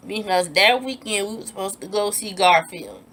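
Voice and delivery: ordinary delivery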